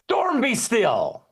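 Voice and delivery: high, slow command to the storm; mildly voiced Jesus stilling the storm; slow, lifted command. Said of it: "And he just goes".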